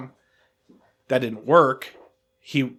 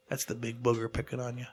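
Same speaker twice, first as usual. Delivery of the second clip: softer, calm guru-like saying; calm monk; 10 dB quieter than usual. Retold or imitated. Storyteller likening the older guy to a monk, lightly voiced. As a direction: intone it like a serene monk. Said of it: "like a monk, a monk say".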